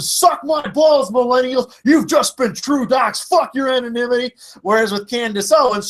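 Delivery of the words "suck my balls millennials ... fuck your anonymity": mock scream. Imitating an online ranter screaming at millennials, mock yell. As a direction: imitate someone screaming abuse, pitched up. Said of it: "went in there and sa-, and screamed".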